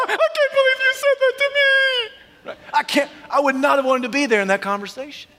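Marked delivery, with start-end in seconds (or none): 0.0-2.5 high, hammed-up tearful wail; exaggerated crying falsetto; mock crying. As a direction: squeal it out like a comically weeping wife. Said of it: "she started to cry".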